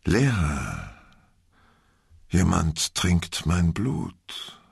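very slow, mock-fading dread; faltering, freezing voice. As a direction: let the voice drain away as if dying. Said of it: "mit verzagter und erstarrender Stimme".

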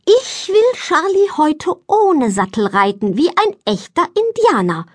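high-pitched eager declaration; child proclaiming her big plan; proud announcement. A narrator's reading. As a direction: announce a daring idea with excitement. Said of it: "verkündet Greta".

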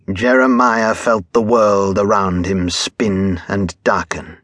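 plain reading voice

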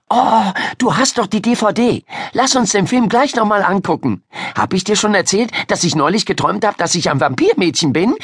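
put-on high girl's babble, gathering speed; nonstop chatter. A narrator's reading. Miriam planning a film night with Ida, chattering. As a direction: chatter on eagerly without waiting. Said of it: "Miriam redete und redete".

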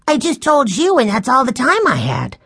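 put-on high-pitched child's protest; excited young boy. A narrator's reading. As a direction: explain in a breathless rush of boyish excitement. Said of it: "answered Benny".

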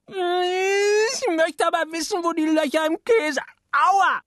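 put-on high little-boy voice, pestering; a small boy nagging his mother at the table; whiny child. Little boy pestering his mama at dinner, high child voice.

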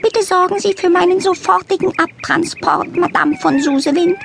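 high, prim and demanding; a posh cat giving orders; haughty cat lady. A narrator's reading. Frau von Schmidt voice.

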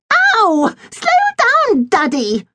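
high, complaining little sister; whiny girl voice. A narrator's reading. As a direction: whine it like a spoilt girl. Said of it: "grumbled Millie Montague".

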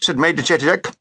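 usual speaking voice

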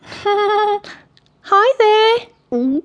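high-pitched timid character voice; nervous Michael. A narrator's reading. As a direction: squeak out a strained, pleasant greeting. Scared Michael greets the queen.

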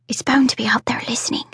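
unvoiced breathy hush, barely there; barely audible whisper. A narrator's reading. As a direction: whisper so the dragon can't hear. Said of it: "in a barely audible whisper".

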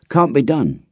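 a hint of a country boy; boy's voice. A narrator's reading. Runtu answering his friend, lightly voiced boy. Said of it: "he said".

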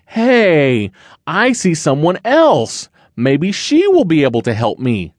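put-on lightly excited young cub; hopeful cub. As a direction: perk up at seeing someone. Little Bear spots someone.

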